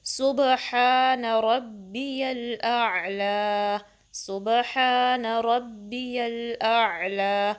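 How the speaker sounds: slow, drawn-out chanted recitation; measured tajweed recitation; slow Quranic recitation. Shown as a demonstration. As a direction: recite it carefully, stretching the long vowels. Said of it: "कैसे सही से पढ़ना है".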